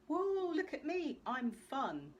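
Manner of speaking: hammed-up cutesy poser squealing look at me; mocking a poser in her best outfit, squealing high; mock showoff. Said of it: "kind of like".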